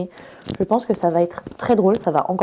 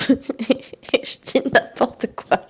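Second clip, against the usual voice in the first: laughing at herself; 4 semitones higher, much slower than usual. Real, not acted. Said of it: "je parle pas bien".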